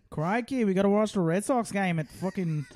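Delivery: Australian Red Sox fan; Australian accent. Acted. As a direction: complain in an Aussie accent. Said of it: "That's not a bad Australian".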